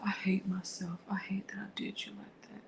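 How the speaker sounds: hushed, voiceless self-hatred; whispered remorse. Retold or imitated. Abusive partner whispering his regret to her. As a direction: whisper it like a guilty abuser begging. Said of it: "Then he'd be like".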